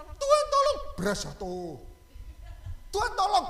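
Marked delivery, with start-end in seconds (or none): 0.0-0.8 high, loud pleading wail; mock desperate plea. Membayangkan doa minta tolong. 2.9-3.5 high, loud pleading cry; mock desperate plea. Membayangkan doa minta tolong.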